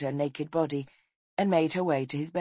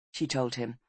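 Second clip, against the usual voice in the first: ordinary delivery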